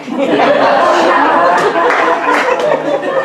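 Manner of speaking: big, high, loud laugh; loud burst of laughter. Real, not acted. Laughing at his dad's Plymouth Horizon, loud laughter.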